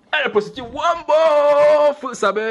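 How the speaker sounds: high, loud scandalised exclamation; loud gossiping cry; mock gossip shout. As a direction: blurt it out like a shocked busybody. Gossip exclaiming about a prostitute.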